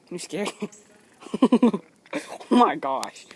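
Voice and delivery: ordinary talk, nothing marked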